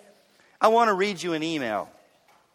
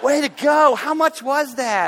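higher, louder cheer; proud coach cheering; excited praise; 8 semitones higher, 5 dB louder, slower than usual. Retold or imitated. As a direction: son cheering on his dad. Son praising dad.

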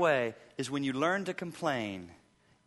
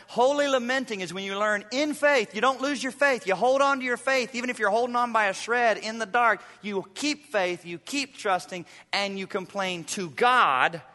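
rising, louder and higher preacher's push; preaching at full force; 6 semitones higher, 5 dB louder than usual. Acted. Pastor urging his flock to hold on to faith, fervent preaching. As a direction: drive the point home to the congregation.

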